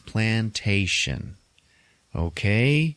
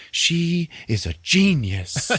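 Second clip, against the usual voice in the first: high, drawn-out incredulity; acted-out astonished admiration, slowly emphasized; mock amazement; 9 semitones higher, slower than usual. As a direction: stretch it out in high mock awe. Acted exasperated admiration after call.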